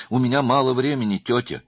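high, loud, pressed plea; urgent phone-call insistence. A narrator's reading.

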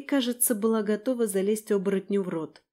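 plain reading voice

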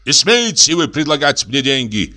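high-pitched outraged refusal; angry cry. A narrator's reading. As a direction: cry out, insulted by the offer. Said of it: "крикнул доктор". (возмущённый выкрик)

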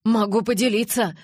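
anxious, pleading offer. A narrator's reading. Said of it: "умоляющим голосом проговорил Джейкоб".

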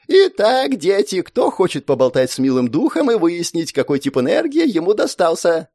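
cheerful teacher calling class. Acted. Teacher addressing children.

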